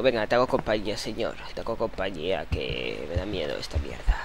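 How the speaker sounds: scared quiet comfort to the fetus; soft comforting aside. Pretend. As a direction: keep the baby company in a hush. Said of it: "voy a decirle yo".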